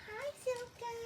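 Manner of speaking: bright high-pitched hello to the puppy; high puppy greeting. Real, not acted. Trainer greeting her puppy in a high sweet voice.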